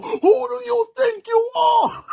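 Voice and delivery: high, loud, slowed shriek across the table; hammed-up screeching outrage; furious yell. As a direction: scream it at the cheaters. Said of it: "She's yelling across the table".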